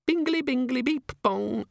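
very high, chirpy little device voice; tiny imp chiming. A narrator's reading. The pocket organiser imp announcing itself to Vimes, chirpy. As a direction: sing out the chime like a small gadget.

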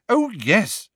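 mock the idea he is small; hysterical sarcasm. Hermione to Hagrid about his giant brother, sarcastic. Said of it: "with a kind of hysterical sarcasm".

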